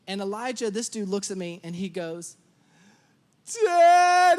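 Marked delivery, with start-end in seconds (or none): 3.6-4.4 loud, high child's wail, imitated; mock tantrum shriek for a parent; screaming cry. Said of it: "Starts screaming, crying my name".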